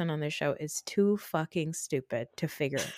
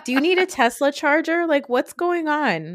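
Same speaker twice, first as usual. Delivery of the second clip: incredulous high voice; 6 semitones higher, 4 dB louder than usual. Retold or imitated. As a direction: ask it like nobody makes sense. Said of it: "I'm like".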